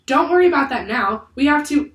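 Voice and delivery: high, loud, hurried insistence; urgent brushing-off. A narrator's reading. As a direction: push past the question, then get cut off. Annie urgent, gets cut off.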